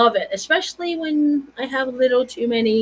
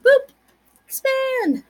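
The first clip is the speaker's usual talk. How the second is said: much higher, falling expand; slower than usual. Retold or imitated. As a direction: say it like the screen should zoom. Mimes zooming the photo.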